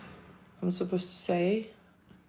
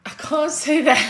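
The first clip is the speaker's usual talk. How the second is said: laughing refusal; 8 semitones higher, 9 dB louder than usual. Real, not acted. Refusing to say a rude word.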